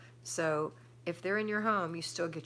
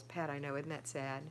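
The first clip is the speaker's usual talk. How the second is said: usual speaking voice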